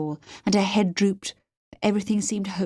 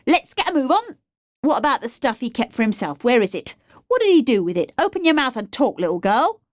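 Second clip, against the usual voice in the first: high-pitched staccato demands; staccato bullying; 5 semitones higher, 4 dB louder than usual. A narrator's reading. Bracelet Boy interrogates the captive girl, staccato bullying. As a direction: jab out each question, pushing for an answer. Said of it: "speaking staccato fashion".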